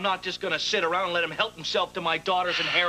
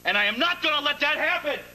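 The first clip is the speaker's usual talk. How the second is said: determined outburst; 12 semitones higher than usual. Acted. Vows to stop Danielle.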